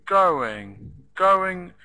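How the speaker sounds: going said loud, GO punched; stressed first syllable. Shown as a demonstration. Teacher models stressed verb.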